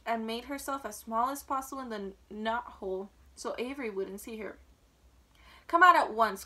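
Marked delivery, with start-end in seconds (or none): 5.7-6.4 loud motherly order; sharp raised command; cried out. A narrator's reading. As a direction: call the child out forcefully. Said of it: "cried Missus Arable".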